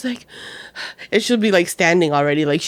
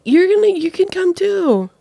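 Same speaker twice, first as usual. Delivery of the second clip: high, louder placating mom voice; mock reassuring; 11 semitones higher, 5 dB louder than usual. Mom placates her worried daughter, acted high-pitched reassurance. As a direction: act out hurriedly including an upset kid.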